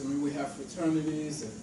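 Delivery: ordinary delivery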